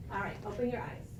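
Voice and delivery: normal talking voice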